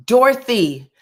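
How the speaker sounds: excited recall. Real, not acted. Remembering the nickname aloud.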